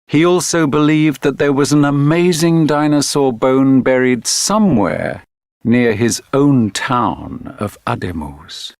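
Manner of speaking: ordinary delivery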